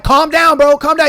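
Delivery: mock urgent plea to calm down. Friends urging panicking Nolan, high and loud.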